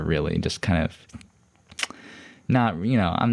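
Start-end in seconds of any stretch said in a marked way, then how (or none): none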